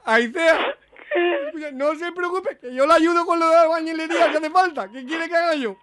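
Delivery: high, sobbing mock consolation; fake crying. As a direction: blubber as if heartbroken. Prankster keeps fake crying. (llanto de broma exagerado)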